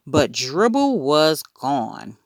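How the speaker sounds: ordinary delivery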